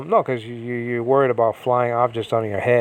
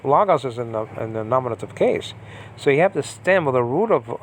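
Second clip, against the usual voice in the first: ordinary delivery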